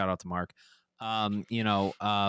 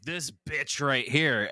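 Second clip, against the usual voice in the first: mock taunt; 5 semitones higher, 4 dB louder, much slower than usual. Retelling the high guy's taunt.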